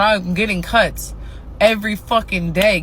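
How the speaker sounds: usual speaking voice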